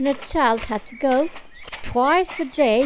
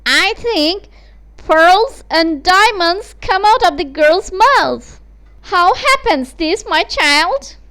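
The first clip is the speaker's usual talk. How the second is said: higher, louder, pressing mother; eager mother; 6 semitones higher, 6 dB louder than usual. Acted. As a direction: demand it breathlessly, greedily. Greedy mother questions daughter.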